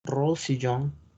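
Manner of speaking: Rosillon slow, rosiyon; French pronunciation. Shown as a demonstration. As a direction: model the French name slowly. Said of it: "Rosellón es Rosillon". (Rosillon despacio a la francesa)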